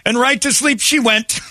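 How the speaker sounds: incredulous. Real, not acted. Incredulous retelling.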